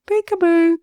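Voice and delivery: put-on high, loud sing-out as if to a baby; playful peekaboo. Narrator mimicking the game played with a baby, playful peekaboo.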